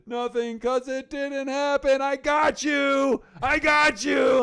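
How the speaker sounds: high, drawn-out triumphant yell; gleeful shout. Retold or imitated. Friend revealing the firing was a prank, gleeful shout. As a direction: crow the prank reveal at full voice, imitating the prankster.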